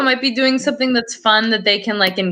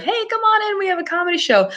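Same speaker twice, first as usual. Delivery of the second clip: calling out; 11 semitones higher, faster than usual. Retold or imitated. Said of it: "someone was outside like".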